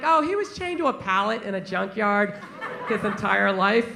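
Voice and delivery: deadpan her like it's nothing; shelter worker. Retold or imitated. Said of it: "She was just like".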